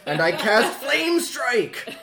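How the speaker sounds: slow, high spell declaration at full volume; triumphant shout. Pretend. Player announcing a spell to the table, loud and triumphant. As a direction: shout the spell name like a battle cry.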